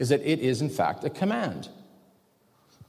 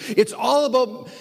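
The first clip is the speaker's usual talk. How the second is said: preaching climax; 8 semitones higher, 4 dB louder than usual. Real, not acted. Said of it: "preaching climax".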